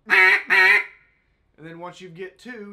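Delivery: loud honking quacks on a duck call; duck call honks. Shown as a demonstration. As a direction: blast two quacks on the call. Demonstrating blown duck call.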